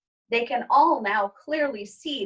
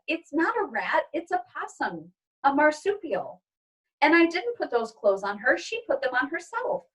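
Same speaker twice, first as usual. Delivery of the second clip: put-on higher little girl voice; a girl insisting her pet is innocent; child's voice; 6 semitones higher than usual. Izzy correcting her mother, higher child voice.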